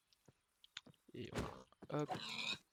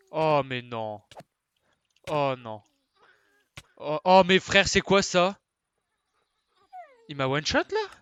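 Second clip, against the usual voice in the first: loud shocked yelling; real outrage at sudden death; shocked shout; 5 semitones higher, 21 dB louder than usual. Real, not acted. Killed in game, shouting in shock.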